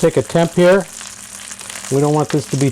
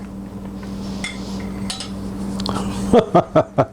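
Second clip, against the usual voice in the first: soft laugh; 4 semitones higher, 7 dB quieter than usual. Real, not acted. Laughing at the skinny kids joke.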